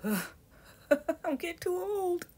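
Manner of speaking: high, laughing mock whine; mock lament. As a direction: play up feeling old with a laugh. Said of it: "You're like".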